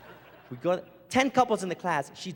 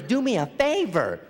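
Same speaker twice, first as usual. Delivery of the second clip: slow laughing; 3 dB louder, slower than usual. Retold or imitated. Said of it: "Everything was".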